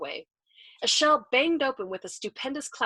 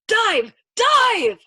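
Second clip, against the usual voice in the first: high, very loud scream; screamed command; 13 semitones higher, 12 dB louder than usual. A narrator's reading. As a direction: scream it twice, the second bigger. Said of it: "to McWatt he screamed".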